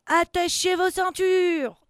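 loud, high, slow captain's call; shouted order. Acted. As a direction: stretch out the command for the crew. Captain's order in submarine game. (ordre de capitaine crié, traînant)

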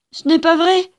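put-on high, loud child's protest; indignant cry. A narrator's reading. Wronged little girl contradicting her accuser, loud cry. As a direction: cry out against the lie. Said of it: "cria Antonella".

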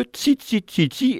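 pépiement aigu de mésange; bird chirping. Acted. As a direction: gazouille comme un petit oiseau. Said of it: "Elle pépia".

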